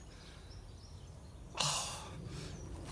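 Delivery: long, deep sigh, overcome with feeling; emotional sigh. Real, not acted. Gardener moved by nature, heaving an emotional sigh. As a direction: let the sigh carry the emotion before speaking. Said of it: "getting very emotional".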